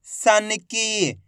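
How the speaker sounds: सन की very slowly sounded out as one word; drawn-out long ई. Shown as a demonstration. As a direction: stretch की in सन की. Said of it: "खींच के पढ़ा जाता है".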